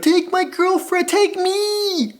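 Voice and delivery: high, stretched mock plea; frantic male fans. Other dudes begging Myles at the game, high frantic pleading. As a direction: cry out like star-struck fans. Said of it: "Other dudes were like".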